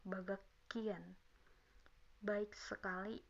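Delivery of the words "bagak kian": "bagak kian" with the h turned into k; natural reading. Shown as a demonstration. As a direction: say "bagak kian" with a held hard k. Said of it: "atau bisa dibaca".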